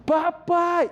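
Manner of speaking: a loud call of Papai, high up; child calling out. Retold or imitated. Son in the bathroom calls his dad, loud and high.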